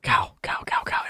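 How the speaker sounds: hushed whisper calling the cow. Real, not acted. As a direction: whisper so it won't run off. Sneaking up on a cow.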